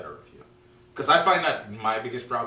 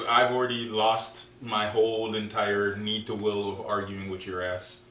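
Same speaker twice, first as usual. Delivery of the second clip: ordinary talk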